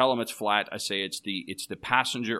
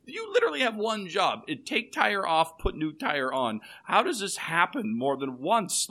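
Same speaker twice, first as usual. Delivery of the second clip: high, disbelieving rant about one job; exasperated rant; 8 semitones higher than usual. Real, not acted. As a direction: spell it out like it's obvious. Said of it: "listener laughs".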